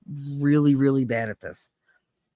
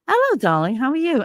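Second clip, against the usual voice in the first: put-on British accent; 9 semitones higher than usual. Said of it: "Why are you talking like that?".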